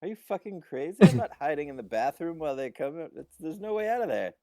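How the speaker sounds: high, loud, acted outrage; indignant refusal. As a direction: protest loudly as an incredulous character. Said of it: "respectfully says".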